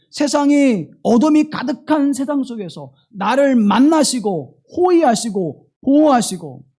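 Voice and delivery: loud, high-pitched sermon climax; impassioned preaching. Real, not acted. Preacher assuring the congregation God guards them, impassioned. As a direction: preach it out with full force.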